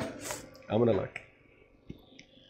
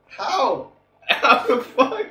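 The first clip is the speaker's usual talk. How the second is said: laughing disbelief; 12 semitones higher, 4 dB louder, much slower than usual. Real, not acted.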